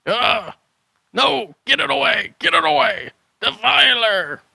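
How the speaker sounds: high, loud, panicked recoil from the money; panicked shriek. Acted. Hermit recoils from the offered cash, shrieking in panic. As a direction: shriek it away like it burns.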